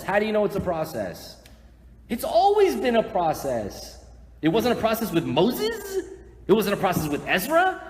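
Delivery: loud, high incredulous questions; impassioned arguing. Real, not acted. Preacher challenging the crowd about the process, impassioned arguing. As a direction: push the challenge out at full force.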